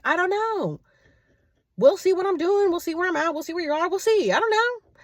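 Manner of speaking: high, teasing sing-song dodge; coy brush-off. Retold or imitated. Her playful dodge when the date asks again, high teasing.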